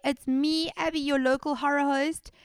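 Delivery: high, loud show-host greeting; hammed-up horror host hello; horror host intro. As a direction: welcome the viewers like a spooky show host.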